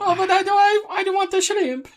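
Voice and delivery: high whiny voice insisting on shrimp; high mock wife. Friend teasing, imitating the wife's shrimp demand, high and whiny. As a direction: pout it out like a spoiled wife.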